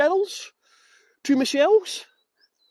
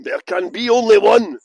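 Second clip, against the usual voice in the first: booming Highlander catchphrase, much louder; movie line; 10 dB louder than usual. Pretend. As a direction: declaim it like the film trailer. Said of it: "the movie Highlander".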